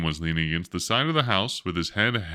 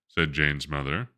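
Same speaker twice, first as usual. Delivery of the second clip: ordinary delivery, nothing marked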